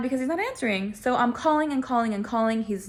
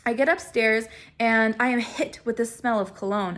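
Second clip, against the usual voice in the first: normal talking voice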